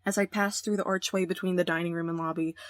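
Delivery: normal talking voice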